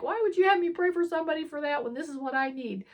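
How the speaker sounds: high, fast, choked-up question pouring out; tearful plea. Retold or imitated. In the car after praying for her, tearful high fast plea. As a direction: ask God why through tears. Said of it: "And again, I start crying".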